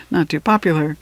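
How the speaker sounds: plain reading voice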